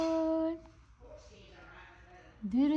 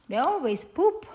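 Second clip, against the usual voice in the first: ordinary delivery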